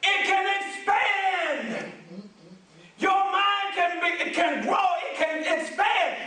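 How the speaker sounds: booming motivational speech. Acted. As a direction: build it up like a preacher. Preaching at full force.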